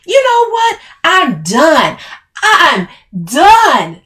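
very high, loud outburst; furious roar. A narrator's reading. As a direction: roar it like quitting for good. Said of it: "she roared".

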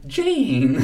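high, loud call across the stage. Retold or imitated. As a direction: call the name out like Peter Pan. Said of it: "Peter Pan at the end".

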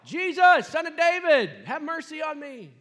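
high, loud desperate cry for mercy; shouting out. Retold or imitated. Blind beggar shouting to Jesus over crowd, loud desperate plea. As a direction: call out over the crowd, pleading. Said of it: "Bart starts to yell".

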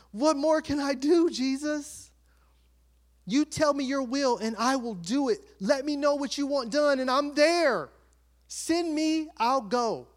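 high, fervent offer to God; volunteer keenly, pitch lifted, as if overjoyed; acted-out zealous pleading; eager devotion. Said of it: "Like, I'm so emotional".

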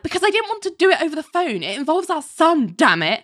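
high, fast mock of Jamie explaining. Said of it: "And Jamie's like".

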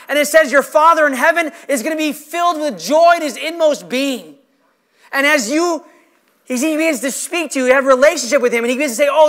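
projected pulpit fervor; impassioned preaching. Real, not acted. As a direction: drive each point home to the congregation. Preaches to the congregation.

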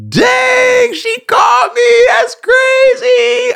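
very high, loud, drawn-out mock surprise; mock careless reaction. Younger self shrugging off the girl's call, mock amazement. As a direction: play your careless younger self, over the top.

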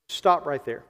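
ordinary talk, nothing marked

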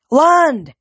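loud, high urgent shout; yelled command. A narrator's reading. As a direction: shout the order across the sky. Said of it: "Annabeth yelled".

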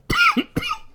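sudden rough cough; harsh cough cutting in; chesty cough. Real, not acted. Coughing fit during list.

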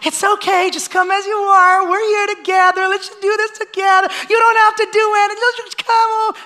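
high, sugary, overly gentle voice; mock soothing church. Preacher mocking a comfortable church coaxing newcomers, sweet and high. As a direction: coo it like a church that asks nothing of you. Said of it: "a church that's going to just be like".

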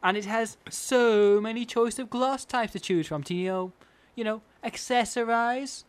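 lightly put-on high socialite voice; Paris Hilton. Host playing celebrity on glass choices, mock socialite. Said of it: "That's what Paris Hilton".

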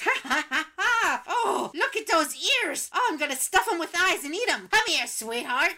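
cackling, high-pitched hag voice; menacing goblin woman. Acted. Goblin woman bursts in on Poog, gleefully menacing. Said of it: "she swings the door open and she goes".

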